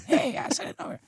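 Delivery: very high, airy joke voice; exaggerated impersonation in a thin whispery squeak. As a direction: put on a silly, sky-high voice. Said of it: "No, he doesn't talk like that".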